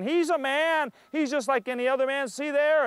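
mocking crowd. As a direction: jeer like a scornful crowd. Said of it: "crowds mocking, laughing, saying".

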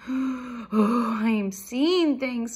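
loud, slow, startled wail; spooked storybook mouse; alarmed squeak. A narrator's reading. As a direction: draw it out in rising alarm. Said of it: "squeaked Annie suddenly".

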